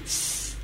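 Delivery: short, soft hissed "tss"; demonstrated tss. Shown as a demonstration. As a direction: let out a quiet tss. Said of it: "Dices así, tss". («tss» suave, sin voz)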